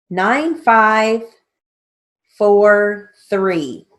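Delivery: slow, spelled-out dictation; dictating. Shown as a demonstration. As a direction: read it out slowly for the class. Steno teacher dictating names slowly.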